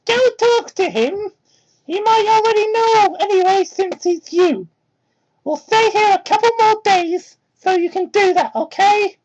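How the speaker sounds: put-on high kid voice, worried and kind; little fox kid voice. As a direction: play a sweet young kid, eager to help. Young Tails advising Sonic.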